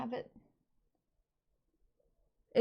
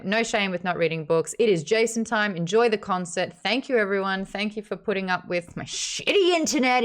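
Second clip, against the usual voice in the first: ordinary talk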